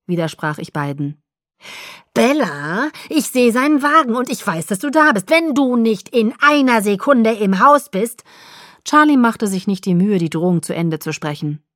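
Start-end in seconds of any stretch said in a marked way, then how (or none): 2.1-8.2 louder, higher calling threat; threatening father. A narrator's reading. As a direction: shout the warning from the porch. Charlie threatening from house.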